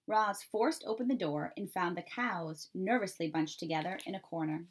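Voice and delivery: ordinary delivery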